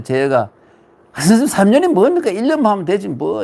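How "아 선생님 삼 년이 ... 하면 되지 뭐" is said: cheeky disciple. Acted. Disciple challenging Confucius, acted quote.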